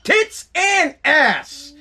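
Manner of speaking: high, very slow word-by-word holler; exaggerated, stretched-out Texan bellow; Texan boss impression. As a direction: spell it out slowly as a Texan boss. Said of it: "The guy was from Texas".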